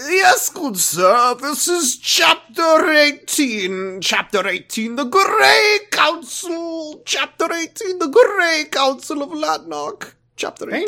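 put-on slow, high old wizard. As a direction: intone like a grand old wizard. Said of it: "can you do, like, a cool wizard voice?".

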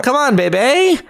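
put-on high, pleading "baby" ending on a big "bay"; wheedling high "bay-bay" on "baby"; heavy A in baby. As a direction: coax with a soaring, stretched A in "baby". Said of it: "I say baby with a heavy emphasis on the A".

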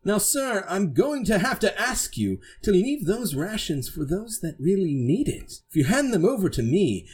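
high, swooping knightly voice; formal, insistent knight demanding compliance; Sir Braddock. Acted. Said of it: "puts his hand on his hilt and go".

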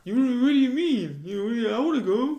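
put-on loud, high whiny kid; a kid loudly pleading to sleep over. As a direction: beg your mom at the top of your voice. Kid begging mom to stay over.

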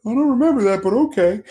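high, loud, puzzled shrug; mock confused fan. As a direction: say it with a baffled high lilt. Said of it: "and I'd be like".

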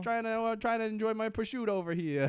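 a mobster shooing people off, hammed up; Tony Soprano impression. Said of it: "Tony Soprano walks out and goes".

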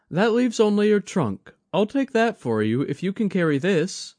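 put-on young woman's voice, worried and kind; Mai, young woman. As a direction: play Mai fussing over an elder. Mai speaks.

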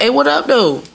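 un-switched street-casual hello; casual caller. Acted. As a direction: greet the receptionist way too casually. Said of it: "If you be like".

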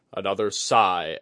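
plain reading voice

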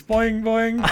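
high springy boing sounds; bouncy springy boingy voice. Shown as a demonstration. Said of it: "I'm gonna do a little boing".

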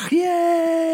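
squealed high yay; excited. Real, not acted. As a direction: cheer in a falsetto squeal. Celebrating the announcement.

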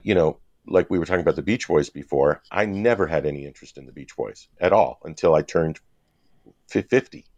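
ordinary talk, nothing marked

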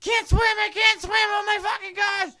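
put-on very high, loud child's shriek; panicked screaming. Child panicking in Nana's pool, acted-out screaming. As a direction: scream hysterically, mocking your younger self. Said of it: "Screaming like a little bitch".

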